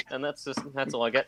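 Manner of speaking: normal talking voice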